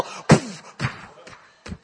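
puffed drum beats dying away; fading drum imitation. Preacher imitates warrior drumming over the hill, fading drum. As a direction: let the drum strokes fade into the distance.

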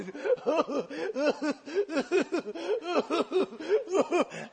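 long, high whimpering sobs; Gollum. Retold or imitated. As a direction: whimper in rhythmic, hammed-up gasps. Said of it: "I was like Gollum".

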